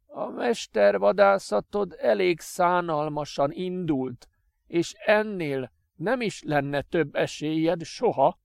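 high, slow, seething threat through clenched teeth; angry through teeth. Acted. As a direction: grind it out in contempt. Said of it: "csikorgatta dühösen a fogait".

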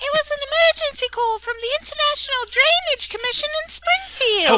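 high, fast put-on boy voice; lightly voiced teen son making an excuse; Tobias's reply. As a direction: rattle off the excuse in a squeaky kid voice. Co-host answers as Tobias.

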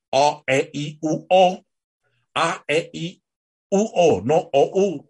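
"o, e, i, u" high and loud, each vowel fully shaped; clear vowel articulation. Shown as a demonstration. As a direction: open the mouth wide on each vowel, "a, e, i". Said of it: "la pronunciación clara de las palabras". (vocales "a, e, i" agudas, abiertas y bien marcadas)

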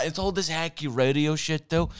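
normal talking voice

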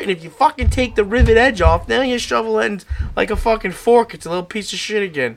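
ordinary talk, nothing marked

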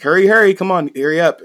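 high, loud excited hurrying; urgent urging. Retold or imitated. Maids calling to their lady as he passes by, urgent urging. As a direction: hustle someone along excitedly.

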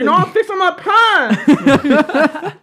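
high, loud, drawn-out excuse; mock protest. Accused customer explains himself to worker, high mock protest. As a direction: imitate a protesting customer, slow and laughing.

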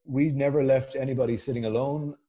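normal talking voice

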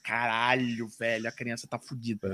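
low, soft stunned reaction; hushed dismay. Retold or imitated. Podcaster voicing a viewer seeing the sick child, hushed dismay. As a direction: drop the voice in dismay.

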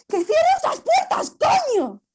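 exploding shout. Acted. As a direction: scream it at the others to shut the doors. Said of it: "Janet estalla y dice". (Janet estalla y grita furiosa)

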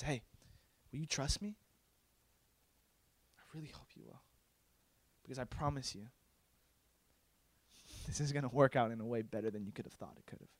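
very soft, low reassurance; kind, sincere. Acted. God reassuring the one who ranted, kind and sincere. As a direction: promise it gently, almost under the breath. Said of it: "In a very kind, sincere voice, he says".

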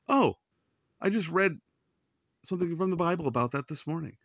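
light up as if it just clicked; surprised realization. Retold or imitated. Friend recalls this morning's reading.

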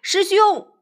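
high-pitched call, easing off. A narrator's reading. Sha Seng calls out to senior brother on the platform, crying out. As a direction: cry out the opening address, then question. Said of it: "忍不住高叫道".

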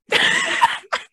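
shrill high laugh burst; high squealing laugh. Real, not acted.

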